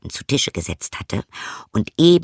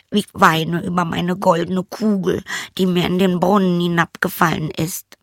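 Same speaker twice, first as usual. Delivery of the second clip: put-on high, light girlish voice, a little dismissive; a young princess shrugging at the frog; 8 semitones higher than usual. Princess brushing off the frog, high girlish voice.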